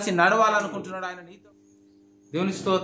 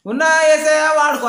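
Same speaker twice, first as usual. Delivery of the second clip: higher, louder, hammed-up plea; mock loud prayer; 6 semitones higher, 6 dB louder than usual. Preacher mocking a loud empty prayer, hammed-up. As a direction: call it out like a showy prayer.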